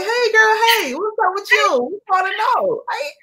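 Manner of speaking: put-on high, chummy girlfriend-style hello; acted fan greeting. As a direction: play an eager fan wanting the gossip. Voices curious fans greeting author.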